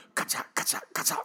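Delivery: soft unvoiced snip-snip of scissors; whispered scissor snips. Narrator to listeners, a soft whispered scissor sound. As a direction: breathe out the clack of the scissors. Scissors cutting the wolf open.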